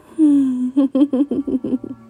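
high playful giggle; flirty giggle. Acted. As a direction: giggle teasingly. Giggle during striptease.

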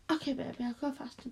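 ordinary talk

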